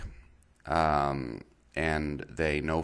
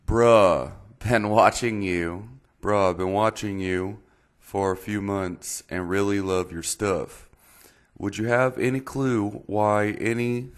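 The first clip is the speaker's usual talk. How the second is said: higher, louder reading in a put-on Texas accent; 4 semitones higher, 5 dB louder than usual. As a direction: read the subscriber's message like a Texan. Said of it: "reading it in a Texas accent".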